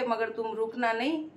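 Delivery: ordinary talk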